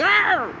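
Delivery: high squeaky mock cry of pain; imitated cape cry. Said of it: "the cape cry a little bit".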